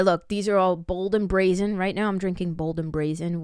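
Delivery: ordinary talk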